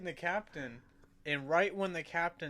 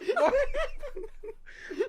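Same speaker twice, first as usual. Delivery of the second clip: high helpless laughter; 8 dB louder than usual. Real, not acted. Cracking up mid story.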